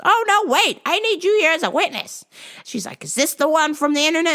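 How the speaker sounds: high, loud stubborn nag; high old wife voice. Retold or imitated. Maggie arguing with her husband, high stubborn insisting.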